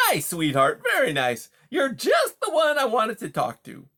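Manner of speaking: high, loud, hearty salesman-like patter; brash, chummy beaver. Acted. Beaver greets a wary mouse as a new pal, brash and chummy. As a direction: play a pushy, overfamiliar beaver, loud and high.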